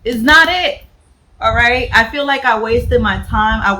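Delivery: loud, high, fed-up rant; mad rant. Real, not acted. Vlogger rants to viewers about the hyped book, loud and angry. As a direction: vent like you've been betrayed. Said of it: "I am so mad".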